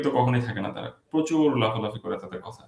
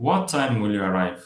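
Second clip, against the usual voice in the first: slow WHAT TIME, falling away to ARRIVE; intonation on WHAT TIME; 3 semitones lower, much slower than usual. Shown as a demonstration. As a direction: put the weight on WHAT TIME, then descend. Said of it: "We have to put our intonation there".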